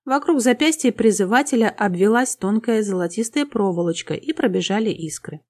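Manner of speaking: plain reading voice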